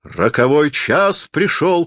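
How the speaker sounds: high, slow proclamation; mild theatrical cry. A narrator's reading. Stage Napoleon proclaims his fateful hour, crying out theatrically. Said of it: "крикнул Наполеон".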